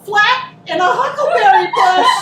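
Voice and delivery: normal talking voice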